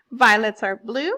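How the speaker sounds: loud rhyme read-out; recited example line. Shown as a demonstration. Teacher reading class an example poem, loud recitation. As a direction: read the verse out firmly at an even pace. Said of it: "For example".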